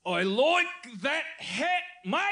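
slow, high mock Australian accent; Australian accent demo. Shown as a demonstration. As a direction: show off a laboured Australian accent. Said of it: "Here's what I've learned so far".

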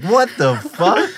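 high, loud, slow disbelief; a kid staring in total shock; shocked outburst. Retold or imitated.

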